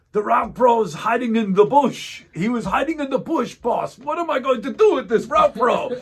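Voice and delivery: put-on high, loud Bosnian-accented complaint; a Bosnian driver, worked up and loud; excited Bosnian accent. Driver complains to the boss about the route pro, loud Bosnian accent. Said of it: "in his Bosnian accent".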